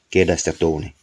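plain reading voice

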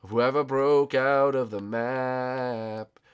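drawn-out words, very slow; measured, slowed-down scolding; slow, deliberate accusation. Real, not acted. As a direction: stretch out each word.

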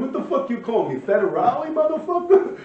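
high, loud, indignant yell at Federale; retold rage, half-laughing; imitating a furious shout. Friend furious at the insult.

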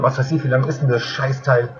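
ordinary talk

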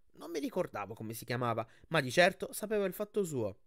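ordinary delivery, nothing marked

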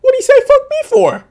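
hammed-up, puzzled character voice; voicing the confused dad. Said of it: "He's sitting there like".

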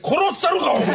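high, exaggerated annoyed outburst; hammed-up seething threat; mock fury. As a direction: snap at him in comic rage. Fuming at partner's singing.

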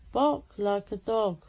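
loud, deliberate order; loud command. Real, not acted. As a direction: say it firmly to the device. Repeats command to Alexa.

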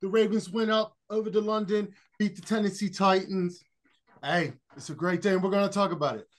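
bad put-on British accent; a lightly done mock British voice; worst British accent. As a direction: ham up a London sportscaster, badly. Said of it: "that is my worst British accent".

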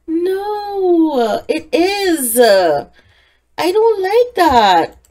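horrified the outfit reverted, gasp and protest; gasping dismay. Real, not acted. Player sees outfit reverting, gasps in dismay.

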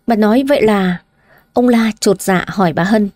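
ordinary delivery, nothing marked

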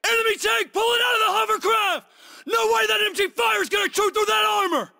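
high, urgent battlefield yell; alarmed shout. Acted. Soldier warning squad of a tank, high alarmed shout. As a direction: warn everyone of the tank.

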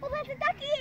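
delighted high squeal; thrilled to spot a duck; excited. Real, not acted. Child excitedly spots a duck.

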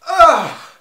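loud cry of pain; pained shout. Real, not acted. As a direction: yelp from burning mouth. Reacting to spice burn.

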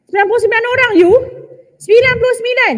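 high, incredulous exclamation; scandalised disbelief; incredulous reply. Acted. Acted shocked reply to killer.

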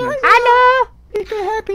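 deutlich höher, piepsig gespielt; hellste Stimme. Pretend. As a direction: wie ein Anime-Mädchen hoch quietschen. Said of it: "die hellste Stimme". (hellste Stimme wie ein Anime-Mädchen)

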